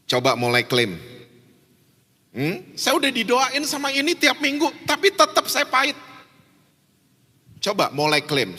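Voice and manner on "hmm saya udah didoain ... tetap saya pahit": high-pitched mock protest; whiny complaint. Imagined listener answering the preacher, protesting. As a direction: complain as a frustrated churchgoer, pitched up.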